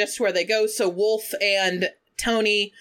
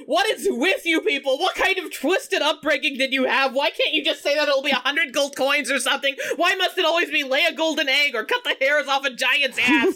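higher-pitched exasperated mock tirade; retold yelling rant; 6 semitones higher than usual. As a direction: act out an indignant outburst without fully yelling. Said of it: "starts yelling".

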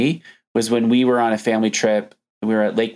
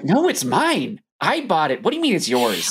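put-on higher-pitched indignant child; freaked-out little girl; 8 semitones higher than usual. Imagined girl defending her swimsuit to a stranger, mock freak-out. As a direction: play a kid protesting in alarm. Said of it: "she would have been freaking out".

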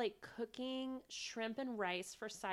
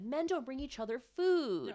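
higher-pitched, indignant quote; mock indignant; 6 semitones higher, 4 dB louder than usual. Retelling husband's retort to his wife, mock indignant. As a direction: protest it, leaning on "food".

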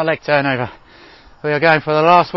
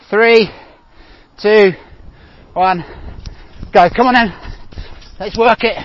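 coach shouting; 6 semitones higher, 4 dB quieter than usual. Real, not acted. Coach shouting during sprint start.

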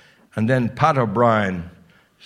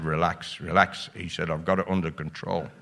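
calm reassurance; 6 semitones lower, 7 dB quieter than usual. Retold or imitated.

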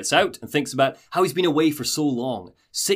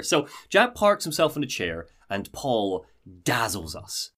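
ordinary talk

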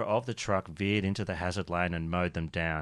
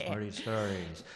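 echo effect; 3 semitones lower, 9 dB quieter than usual. Acted. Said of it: "turn that effect off".

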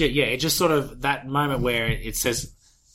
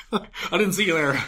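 feigned casual surprise; 6 semitones higher than usual. Retold or imitated. Villain caught out, acting casual.

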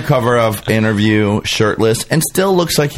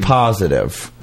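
ordinary talk

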